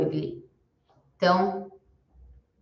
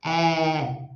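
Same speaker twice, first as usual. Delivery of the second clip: usual speaking voice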